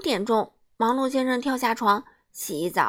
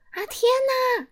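much higher, shocked cry; amazed he's still in bed; high startled exclamation; exclaiming. Acted. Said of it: "他惊呼起来".

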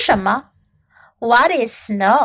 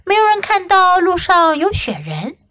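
ordinary delivery

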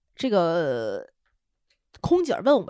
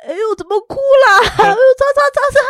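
higher, louder fussing; fussing husband; 14 semitones higher, 10 dB louder than usual. Retold or imitated. Husband panicking over his crying wife, fussing. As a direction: flap around fussing over her. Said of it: "过来问".

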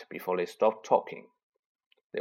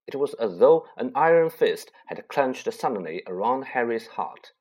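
usual speaking voice

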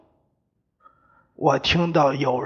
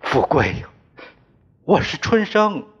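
calling out. A narrator's reading. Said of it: "对我叫道".

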